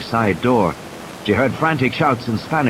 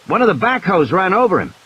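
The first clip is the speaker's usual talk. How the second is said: shouted; 8 semitones higher than usual. A narrator's reading. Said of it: "Ed shouted".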